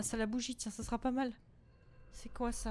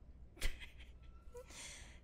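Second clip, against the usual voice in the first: nervous laugh; 13 semitones higher, 7 dB quieter than usual. Real, not acted.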